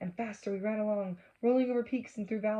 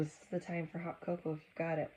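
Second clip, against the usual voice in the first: plain reading voice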